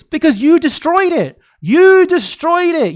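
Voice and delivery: real outrage at Democrats, the stressed you rising to a shout; furious accusation. Real, not acted. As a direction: hurl the blame at them, slow on the second. Angry rant at Democrats.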